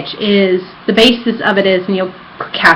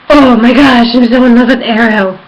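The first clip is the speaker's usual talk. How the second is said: fangirl gush; 2 semitones higher, 7 dB louder than usual. Real, not acted. Fangirling over Arrow.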